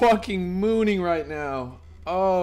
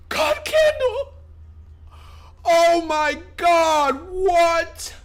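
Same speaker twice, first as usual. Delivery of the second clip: high crying shriek of joy; ecstatic scream; 12 semitones higher, 4 dB louder, much slower than usual. Real, not acted. Streamer screaming at the chart, ecstatic. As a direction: scream it slowly with disbelief.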